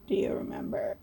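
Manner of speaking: low unvoiced whisper; a hushed question from nowhere; ghostly whisper. Ghost whispering a low question.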